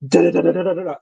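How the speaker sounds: sing-song da da da like bouncing down steps; mock the thud of each stair on the way down; tumbling sounds.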